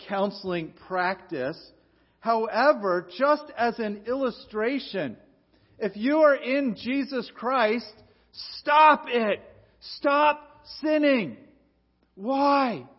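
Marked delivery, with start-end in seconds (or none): 2.2-12.9 louder, higher pulpit command; preaching at full force. Acted. Pastor urging his church to stop sinning, forceful preaching. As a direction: drive the point home to the congregation.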